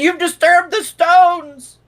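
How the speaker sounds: very high, loud, drawn-out scream of terror; fearful shriek. Acted. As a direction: shriek it slowly as panic takes over. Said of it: "shriek in fear and say".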